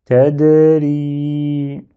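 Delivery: Tedri recited evenly with the last i held long; prolonged final vowel. Shown as a demonstration. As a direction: join "Tedri" smoothly and sustain the final i. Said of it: "je lis le tout".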